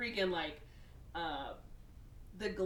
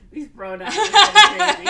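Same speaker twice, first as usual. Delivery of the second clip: laughing loudly while talking, higher pitched; real laughter; 11 semitones higher, 21 dB louder than usual. Real, not acted. Teller muddles he and she, cracking up laughing. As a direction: crack up over the words. Cracks up over mixed-up pronouns.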